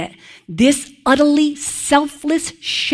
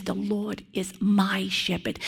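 much softer, personal confession; intimate, with feeling; 3 semitones lower, 10 dB quieter than usual. Pretend. David speaking of his own shepherd, soft and heartfelt. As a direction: say it close, as a private truth. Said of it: "he says it, okay, with such, uh, feeling".

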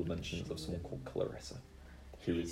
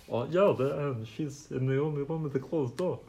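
strange creature voice; 7 semitones higher than usual. Acted. GM voicing strange NPC.

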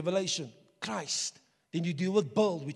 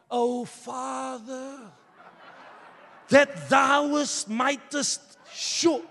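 mock formal prayer; 4 semitones higher, 7 dB louder, much slower than usual. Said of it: "mocking formal prayer".

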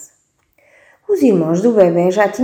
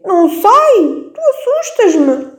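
put-on much higher, slow baby voice with a laugh; scared baby voice; 13 semitones higher, 5 dB louder, slower than usual. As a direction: whimper it like a frightened tiny baby.

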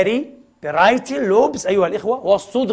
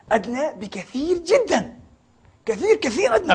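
mock astonished; 8 semitones higher than usual. Mimicking Persinger's astonished verdict.

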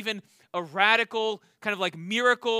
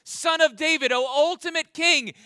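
higher, louder proclamation of a king; fervent acclamation; 5 semitones higher, 6 dB louder than usual. Retold or imitated. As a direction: hail him like a royal herald. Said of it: "Bartimaeus is effectively saying".

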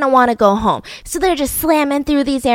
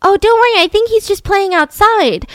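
high, light, offhand friend voice; friend's high, casual brush-off to the panicking mother; breezy reassurance; 9 semitones higher, 4 dB louder than usual. Retold or imitated.

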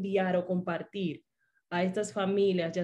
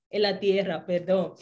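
normal talking voice

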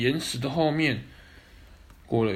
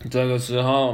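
usual speaking voice

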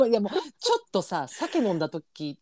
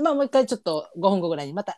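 ordinary talk, nothing marked

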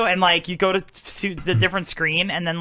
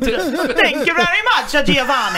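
put-on Italian accent; 5 semitones higher than usual. Host thanking co-host for praise, playful Italian accent. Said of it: "I do a really good Italian accent".

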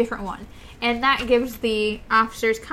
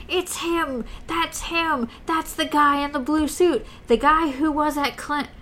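mock scream; 4 semitones higher than usual. A narrator's reading. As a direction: read it as a restrained, excited yell. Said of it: "and scream".

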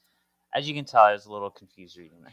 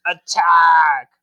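shouted order, louder and higher; battle cry; 7 semitones higher, 13 dB louder than usual. Retold or imitated. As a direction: yell the charge like a commander. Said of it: "Daddy Angel goes".

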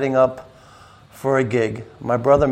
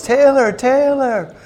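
a much higher, slow drawn-out call; Taylor's mom calling; 12 semitones higher, 5 dB louder, slower than usual. Retold or imitated. Imitating Taylor's mom calling her daughter over at the door. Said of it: "I'll do my imitation".

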